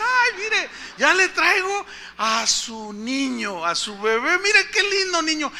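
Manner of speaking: high, slow, beaming nurse voice; cheerful nurse. Retold or imitated. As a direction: play the delighted nurse showing off the baby. Nurse brings the baby. (enfermera alegre, aguda y lenta, presumiendo al bebé)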